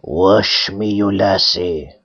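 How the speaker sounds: low, strange voice of a head in the pool. A narrator's reading. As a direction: speak as an eerie head rising from water. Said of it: "ugly head out of the pool and said".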